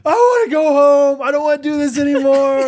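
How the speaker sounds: put-on high, loud whiny crying child; crying kid. Scared son begging his dad to go home, crying. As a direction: sob it out like a scared little boy. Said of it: "he's just screaming and crying".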